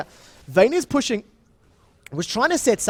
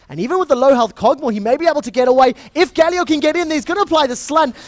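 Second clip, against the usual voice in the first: excited play-by-play; 8 semitones higher, 6 dB louder than usual. Acted. Live play-by-play of fight.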